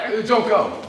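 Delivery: sudden loud high call; urgent plea. Acted. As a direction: stop her from leaving, loudly. Suitor stops her leaving.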